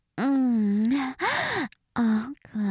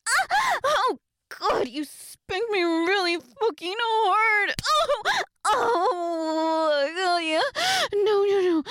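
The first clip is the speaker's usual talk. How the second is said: acted pained cries; 7 semitones higher, slower than usual. Acted spanking reaction.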